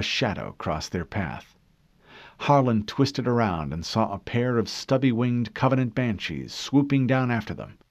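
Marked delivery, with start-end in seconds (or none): none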